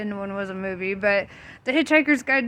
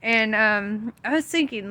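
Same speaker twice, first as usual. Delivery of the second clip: ordinary talk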